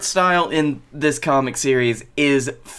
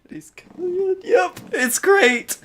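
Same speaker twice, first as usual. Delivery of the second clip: much higher put-on voice, hammed up; AJ character voice; slower than usual. Cohost playing AJ in a comic reading, silly high voice. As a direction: squeak it out in a silly high pitch. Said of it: "I need to rework my A J character".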